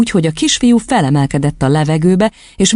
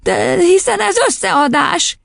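put-on very high, slow boyish protest; crying out; slower than usual. A narrator's reading. Boy objecting to the sum, crying out. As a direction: exclaim in a child's voice. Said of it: "kiáltott fel Vilmos".

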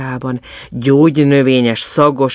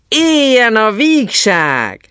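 high, loud, drawn-out hurrah; jubilant cheer; 11 semitones higher, 6 dB louder, slower than usual. A narrator's reading. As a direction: cheer slowly for all to hear. Carnival figure cheers for merriment.

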